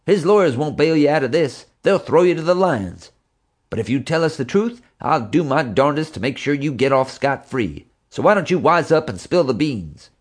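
angry tycoon. A narrator's reading. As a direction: hammer him with threats then a deal. Angry J J lecturing suspect.

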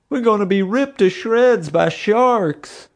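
very high, slow wailing; hammed-up wail of sheer panic; moaning. A narrator's reading. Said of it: "Bubba moaned".

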